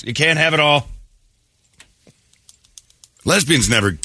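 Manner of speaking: ordinary delivery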